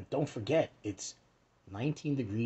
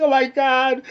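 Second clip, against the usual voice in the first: much higher and louder, a hammed-up scared squeal; mock frightened gasp; 12 semitones higher, 15 dB louder than usual. Kid scared of Iron Maiden, hammed-up frightened squeal. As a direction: play the terrified born-again kid.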